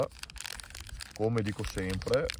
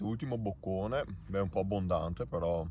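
chewing while talking; muffled by food; mouth full; 3 dB quieter than usual. Real, not acted. Seen 0: Bites food at -1.0, lips closed chewing through labelled words.

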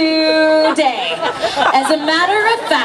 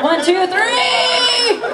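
high, slow shouted count; 8 semitones higher, much slower than usual. Acted. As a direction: call the number out big. Hypnotist calls out the count.

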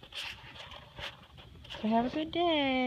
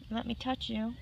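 usual speaking voice

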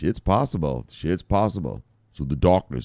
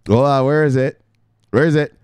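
ordinary delivery

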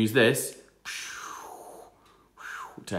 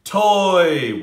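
ordinary delivery

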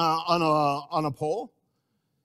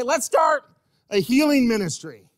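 eager proposal; 5 semitones higher, 5 dB louder than usual. Acted. Early church members eagerly proposing.

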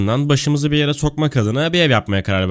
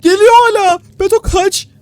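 being chased; 6 dB louder than usual. Real, not acted. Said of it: "Attığım çığlığı duydun değil mi".

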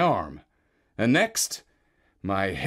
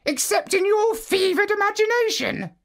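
a calm, high parrot giving advice. Acted. Parrot tells Marlowe to remove goggles, high bird voice. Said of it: "said the parrot".

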